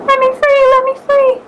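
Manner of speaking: frightened mouse begging the lion, high and pleading. Acted. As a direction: beg for your life as a tiny mouse. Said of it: "He begged to be set free".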